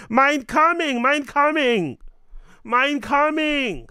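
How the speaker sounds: put-on high, loud, slow German accent. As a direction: drag out a hammy German accent. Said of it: "In my German accent".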